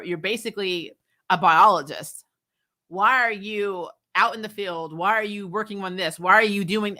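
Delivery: normal talking voice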